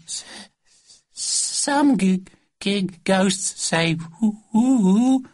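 high, slow stuttering with drawn-out woo; terrified stammer. Acted. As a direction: stammer fearfully, ending in a ghostly woo. Frightened boy insists on ghosts.

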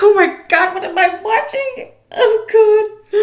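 shocked, nearly crying oh my God; high horrified gasp. Retold or imitated. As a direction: squeal in disbelief. Horrified at the cat scene.

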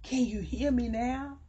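high plea to be heard; preaching plea. Acted. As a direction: call out to the congregation. Preacher calls to congregation.

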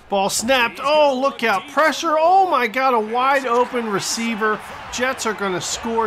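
loud, high burst of alarm; alarmed play-by-play. Real, not acted. Fan spots a wide open receiver, alarmed. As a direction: cry out as the defence breaks. Live call of blown coverage.

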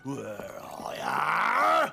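slow call swelling and rising at the end; searching call. Acted. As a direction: draw it out, hunting for someone hidden. Driver searches for hidden passengers.

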